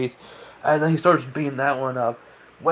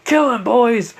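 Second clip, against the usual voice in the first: loud, high attack command; acted villain's rallying shout; shouted order; 10 semitones higher, 9 dB louder, slower than usual. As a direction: call the gang to attack. Gang boss orders attack.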